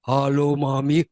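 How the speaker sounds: low, loud man's hello; put-on low male voice; grown man's voice. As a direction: imitate a son's deep grown-up voice. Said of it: "suaranya udah kayak om-om".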